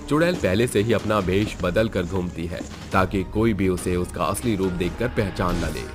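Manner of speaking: ordinary delivery, nothing marked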